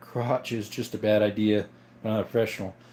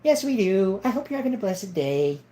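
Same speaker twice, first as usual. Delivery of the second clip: higher, put-on character voice; affected, raised show-off voice; voice-acting character; 5 semitones higher than usual. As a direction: pitch it up into a playful character. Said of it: "We do voice acting sometimes".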